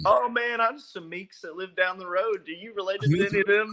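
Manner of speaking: high, excited fan imitation; squeaky eager stranger voice. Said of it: "It's like".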